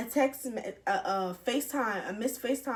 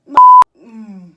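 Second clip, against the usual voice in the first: loud mm; 24 dB louder than usual. Retold or imitated. Imagined reaction hearing name.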